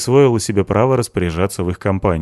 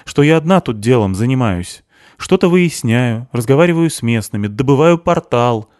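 higher-pitched, mildly aggrieved listing; displeased; 5 semitones higher, 4 dB louder than usual. A narrator's reading. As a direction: list it with peeved emphasis. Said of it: "недовольно скривилась Криспи". (недовольно, с лёгкой обидой)

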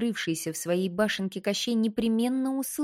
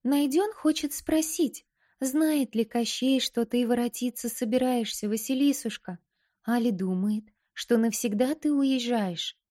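too calm creepy doll; 5 semitones higher than usual. Acted. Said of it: "тоненький голосок куколки".

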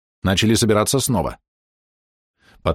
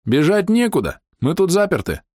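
shouting; 9 semitones higher than usual. A narrator's reading. Shouting while laughing.

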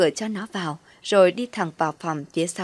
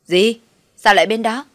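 loud, higher startled outburst; acted shriek of surprise; surprised yell; 4 semitones higher, 8 dB louder than usual. A narrator's reading. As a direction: yelp it in disbelief down the phone. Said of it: "chắc bất ngờ hét luôn".

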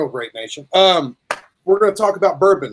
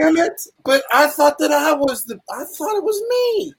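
high whiny voice; 11 semitones higher than usual. Acted. Mock jealousy of Batman.

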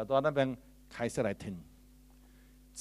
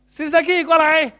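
loud, high call across the room; 13 semitones higher, 16 dB louder than usual. Shown as a demonstration. Preacher demonstrating a loud, high call to Sister Key. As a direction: call her over with force. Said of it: "我又叫".

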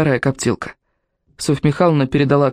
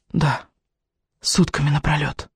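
put-on quiet old man voice; 4 dB quieter, slower than usual. A narrator's reading. Old man echoes from behind.

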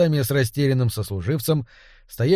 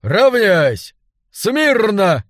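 loud, higher barked commands; shouting drill sergeant; 6 semitones higher, 5 dB louder, much slower than usual. A narrator's reading. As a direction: roar the drill orders. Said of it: "снова проорал Ботрайт".

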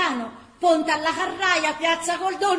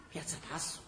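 whispered, much lower; dismayed whisper; 12 semitones lower, 19 dB quieter, faster than usual. Acted. Gina echoes the far-off square, whispered dismay. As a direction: breathe the name in dismay.

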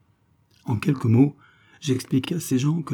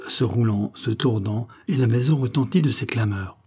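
ordinary delivery

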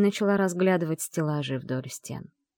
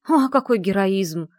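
slow, louder, mocking praise; sarcastic snort; 4 semitones higher, 7 dB louder than usual. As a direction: scoff at his heroics. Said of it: "фыркнула я". (насмешливо фыркнуть)

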